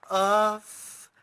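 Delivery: crowd-pumping yell; loud stage cry. Acted. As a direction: belt out a loud hype cry. Performer hypes crowd.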